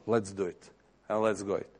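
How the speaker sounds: low, hushed reply; quiet inner voice. Acted. God answering a listening believer, low and quiet. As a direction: answer softly and low, as a voice heard in prayer.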